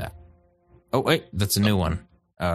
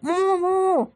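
very high cartoonish wail from inside a stomach; high-pitched comic plea from the belly; Gopher's muffled cries. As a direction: squeal it like someone swallowed, in mock distress. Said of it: "the face impression of Gopher".

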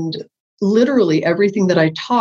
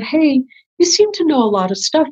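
higher-pitched, eager approach; retold eager request, pitched up; eager student; 8 semitones higher than usual. Woman asking teacher after class, eager.

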